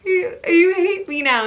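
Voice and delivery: high, loud mock-tearful wail; hammed-up retold whimper; drama queen wail. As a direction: whine it like a self-pitying drama queen. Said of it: "I'm such a drama queen".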